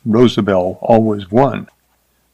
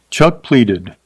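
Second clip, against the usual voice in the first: ordinary delivery, nothing marked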